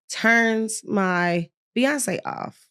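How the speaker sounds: ordinary talk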